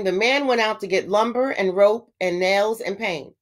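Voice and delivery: usual speaking voice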